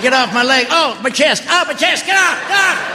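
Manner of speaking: hammed-up panic of a man being crushed; mock crushed yell. Husband pinned under his wife, yelping for air.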